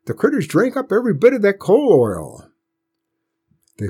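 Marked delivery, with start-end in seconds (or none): 0.0-2.5 grandpa voice. A narrator's reading. Grandpa voiced, raised pitch.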